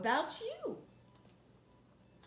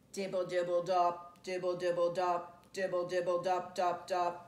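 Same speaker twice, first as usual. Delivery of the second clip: rain dibble dop; 6 semitones lower, 4 dB louder than usual. Shown as a demonstration. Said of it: "He can go like the rain".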